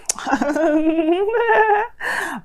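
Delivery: long, rising high-pitched squeal; giddy, sustained laughing squeal; high gleeful squeal. Real, not acted. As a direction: squeal with delight, drawn out very high. Gleeful reaction to the Flirt card.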